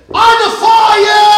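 loud, high giant's taunt; booming challenge. Acted. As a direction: hurl a challenge across the battlefield. Goliath challenges the army.

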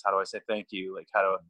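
normal talking voice

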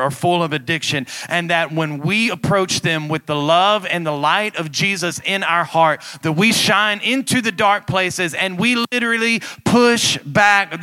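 loud preacher building in intensity; rally the church, rising to a shout; full-force preaching. Real, not acted. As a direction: build from fervent to booming and high.

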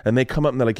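ordinary delivery

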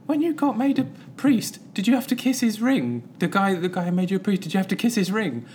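put-on high-pitched kid putting a question; lifted, childlike questioning voice; kids' question. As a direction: pitch it right up like curious children. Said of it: "and the kids went".

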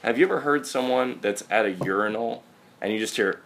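ordinary talk

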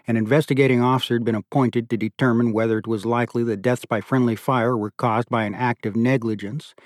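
plain reading voice

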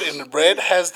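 normal talking voice

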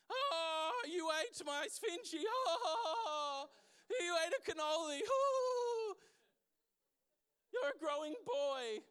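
high, tearful, wailing mamma voice; weepy Italian mother. Retold or imitated. Pastor mimicking a tearful Italian mother doting on her son, high wailing. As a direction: play a sobbing Italian mother fussing over her boy. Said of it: "the Italians who will cry at anything".

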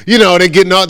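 normal talking voice

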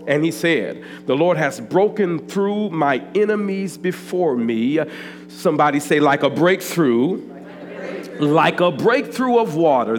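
loud, slow sermon reading over music; full-force preaching. Acted. Pastor preaching scripture loudly over music. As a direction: proclaim the scripture to the church.

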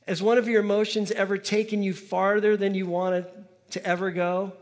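normal talking voice

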